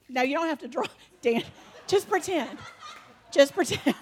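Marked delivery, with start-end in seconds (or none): none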